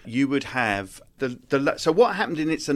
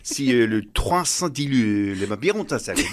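made-up French gibberish, lower, hesitant; fake foreign language; 5 semitones lower than usual. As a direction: invent French-sounding nonsense with French sounds. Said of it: "Can you do a bit now?".

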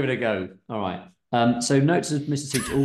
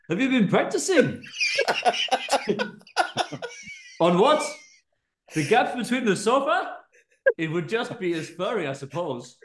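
higher, lilting Welsh voice for Amanda; a slipping Welsh lilt; Welsh accent; 8 semitones higher than usual. Acted. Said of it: "It's going Welsh".

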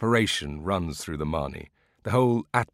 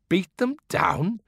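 much higher, slow, appalled question; as if the idea were a scandal; horrified disbelief; 12 semitones higher, slower than usual. A narrator's reading. Villagers horrified at beating olive trees, appalled high question. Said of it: "They were horrified".

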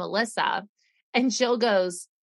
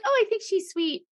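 mock sweet voice; 11 semitones higher than usual. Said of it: "she's like".

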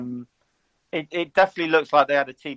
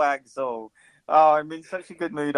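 ordinary talk, nothing marked

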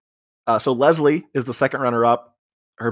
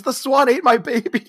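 high, hammed-up panicked wail; mock wail; 12 semitones higher than usual. Gladys over the burning swan float, mock wail. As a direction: cry it out high, imitating a distraught mother.

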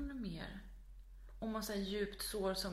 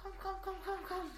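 high, urgent dog call; 11 semitones higher than usual. Retold or imitated. Scared owner calls the dog away from a snake, high calling. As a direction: re-enact coaxing a dog away, pitched way up. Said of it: "kallar på hunden".

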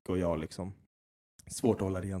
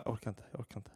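much softer, defeated and drained; worn-out despair; 14 dB quieter, faster than usual. Retold or imitated. Dad imitating his exhausted wife by the freezer, softly defeated. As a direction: say it like you've given up completely. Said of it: "Och Becka bara".

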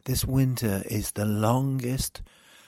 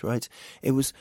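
ordinary delivery

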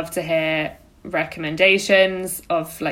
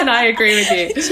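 laughing mock agreement; 4 semitones higher, 5 dB louder than usual.

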